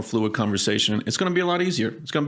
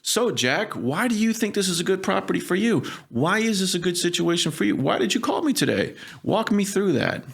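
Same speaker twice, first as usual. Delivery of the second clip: curious open questions; 8 semitones higher than usual. Shown as a demonstration. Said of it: "ask them".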